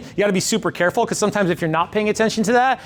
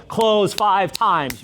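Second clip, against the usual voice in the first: slow emphatic slogan; much slower than usual. Shown as a demonstration. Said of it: "slow emphatic catchphrase".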